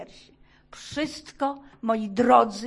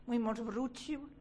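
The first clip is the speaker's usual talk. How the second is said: ordinary talk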